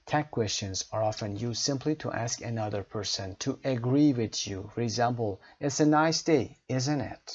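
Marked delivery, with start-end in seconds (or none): 5.6-7.3 "isn't it" drops down at the end; falling tag. Shown as a demonstration. Teacher reading a tag that asks for agreement, falling. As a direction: let the voice fall on "isn't it". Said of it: "said with falling intonation".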